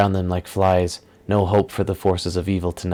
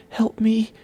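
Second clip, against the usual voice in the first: choked whisper. Acted. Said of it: "she whispered in a choked voice".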